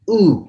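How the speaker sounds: "U" said as the rounded French u, high and stressed. Shown as a demonstration. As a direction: round the lips for "U", push it out high. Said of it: "उ नहीं है ये". ("U" French उ की तरह, ऊँचा और ज़ोर से)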